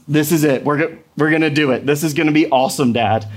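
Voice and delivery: lightly voiced excited little girl, fast; a hint of an eager child hurrying dad; eager daughter. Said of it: "she's like".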